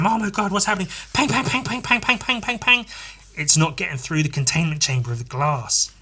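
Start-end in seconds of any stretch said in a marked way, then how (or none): 0.0-2.8 panicked and gunfire. Retold or imitated. Panicked voices and gun sounds.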